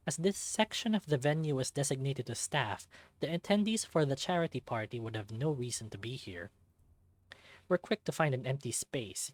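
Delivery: plain reading voice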